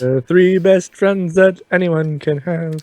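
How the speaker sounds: a sarcastic, slowed sing-song aside. Pretend. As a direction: drip fake sweetness over it. Sarcastic aside about the trio.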